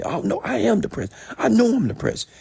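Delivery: very high-pitched protesting imitation; hammed-up squeaky insistence; mock insistence. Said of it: "how they're saying it".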